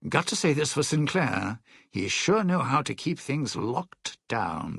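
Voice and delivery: usual speaking voice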